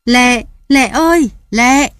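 high, loud desperate yell; ringing shout into the night; shouting her name. Acted. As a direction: bellow her name as she runs off. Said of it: "Ông hét vang vang".

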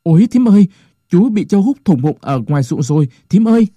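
high, loud panicked cry to his aunt; Cuội panicked. A narrator's reading. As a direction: gasp it out in a sudden fright. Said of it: "giọng hốt hoảng".

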